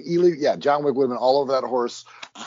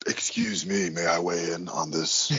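whisper; 7 semitones lower, 5 dB quieter than usual. Acted. Mock intrusion by new character.

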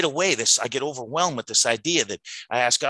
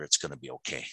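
softly reassuring, much quieter; soft whisper; 6 semitones lower, 7 dB quieter than usual. Retold or imitated. Whispering to his wife in the ICU, softly reassuring. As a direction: murmur it gently into her ear. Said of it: "I whispered in her ear".

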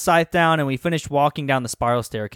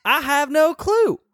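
put-on kid voice; 13 semitones higher than usual. Kid voice in read-aloud scene.

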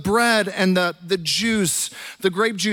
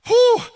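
a sharp high exhale of awe; loud whistling whew; 14 semitones higher, 7 dB louder than usual. Real, not acted. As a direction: blow out a loud amazed whew. Preacher reacts to the claim.

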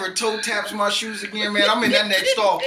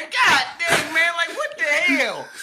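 high, hurt indignation edged with laughter; exasperated protest; 6 semitones higher than usual. Real, not acted. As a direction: plead your grievance, pitch climbing. Hurt protest at friend's prank.

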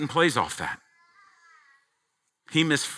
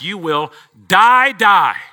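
"die, die" repeated, louder and slow; doubled warning; 5 semitones higher, 7 dB louder, slower than usual. Shown as a demonstration. Preacher demonstrating the emphatic double "die", loud. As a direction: say "die" twice with weight. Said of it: "Again, emphatic".

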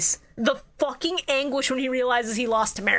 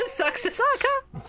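laughing tease; 13 semitones higher than usual. Real, not acted. Teasing co-host about the bit.

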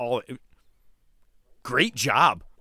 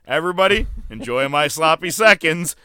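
louder smug mock gloat; CM Punk taunt; 4 semitones higher, 7 dB louder than usual. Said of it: "C M Punk said to everybody".

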